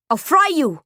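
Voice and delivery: loud, high threat yelled out; yelling. A narrator's reading. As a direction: yell the threat at the enemy. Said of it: "Jules yelled".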